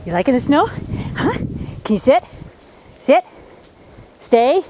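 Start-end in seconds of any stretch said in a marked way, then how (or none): none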